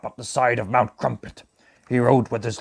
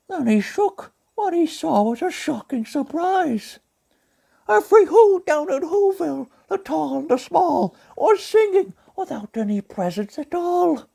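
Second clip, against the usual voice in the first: gentle cartoon bear narrating; Pooh Bear voice; 3 dB louder than usual. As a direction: read it as a gentle honey-loving bear. Pooh Bear reads the story.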